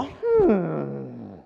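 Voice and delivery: a soft, pondering mock hum; mock thoughtful hum. Preacher voices a listener pondering, soft thoughtful hum. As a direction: play the listener mulling it over quietly. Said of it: "You go".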